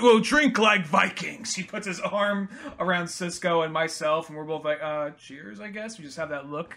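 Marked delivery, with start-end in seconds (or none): 0.0-1.5 slow, lightly booming boast; hearty Viking boast. Retold or imitated. Kyle's hearty toast. 4.8-6.0 much softer, unenthusiastic mumble; reluctant mumble. Retold or imitated. Reluctant toast.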